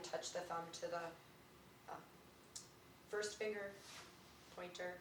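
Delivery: soft, quiet meditation voice; guided meditation. Acted. Teacher guiding the class through finger touches. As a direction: guide them softly through each finger.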